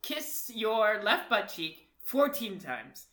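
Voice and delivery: slow, loud, hammed-up declaration; love letter reading. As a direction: read it out like a grand love letter. Reads the silly love letter aloud.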